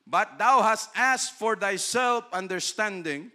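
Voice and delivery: solemn Bible quote, slow and loud; scripture reading. Acted. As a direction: read the verse out slowly and solemnly. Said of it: "reads King James scripture".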